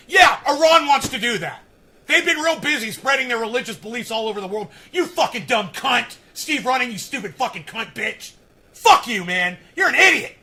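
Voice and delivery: loud, biting sarcastic tirade; sneer, then lash out; angry sarcasm. Real, not acted. Insulting the commenter Steve, loud and angry.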